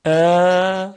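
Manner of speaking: long, open ا held steadily; elongated vowel. Shown as a demonstration. Teacher showing an unobstructed sound, ا held long. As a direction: stretch ا out with free airflow. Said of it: "به این کلمه دقت کنید".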